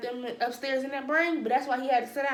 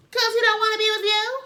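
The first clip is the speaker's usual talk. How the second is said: loud callout; 11 semitones higher, 8 dB louder than usual. Acted. Calling out the friend.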